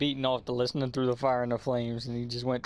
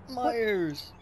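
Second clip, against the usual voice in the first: pained complaint; 6 semitones higher than usual. Real, not acted. Reacting to loud mic spike.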